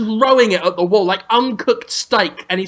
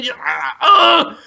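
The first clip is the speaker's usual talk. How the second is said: hammed-up straining noise; straining groan; 6 semitones higher than usual. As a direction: strain hard with a rising groan. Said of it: "then just is straining".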